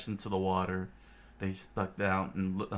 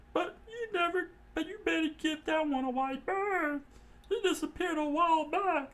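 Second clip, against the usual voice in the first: much higher, cautioning; high warning voice. Acted. Johnny and Yosaku warning Nami about a pirate, high. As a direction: warn her off that pirate.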